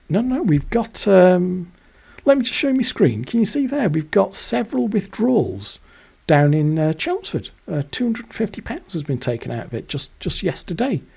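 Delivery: high, fast mock clerk pointing at the screen; a chirpy high clerk showing the screen; bank clerk.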